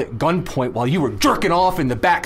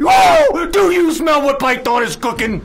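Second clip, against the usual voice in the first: the Rock catchphrase; 9 semitones higher, 5 dB louder than usual. Pretend. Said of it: "I wish I was the Rock".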